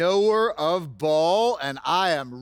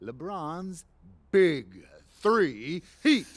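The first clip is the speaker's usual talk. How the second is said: milking the applause; 4 semitones higher than usual. Acted. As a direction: stretch every name like a grand reveal. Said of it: "please hold your applause until the end".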